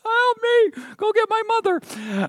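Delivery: put-on very high little-boy voice begging; mock child crying for his mother. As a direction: whine it like a stuck small boy. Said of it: "hears us crying".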